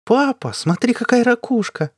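put-on high excited little-girl call; child's shout. A narrator's reading. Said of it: "крикнула Нина".

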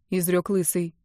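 ordinary delivery, nothing marked

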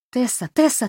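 quick excited string of calls; rapid chattering. A narrator's reading. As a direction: rattle the name off fast and high. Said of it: "затараторила та".

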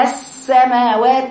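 "السماوات" with the س held out; long seen. Shown as a demonstration. Teacher contrasting with hamza, the seen lengthened. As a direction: linger on the hiss of س in "السماوات". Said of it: "السين طويلة كده".

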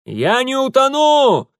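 high, slow, loud call; shouting back. A narrator's reading. Mitya answers Urusov while running off, drawn-out shout. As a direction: shout it back over a distance. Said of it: "прокричал Митя".

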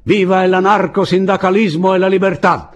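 high, loud rallying cry; exultant political slogan yelled high; shouted cheer. A narrator's reading. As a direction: hail the cause at full voice. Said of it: "gridò Andrés".

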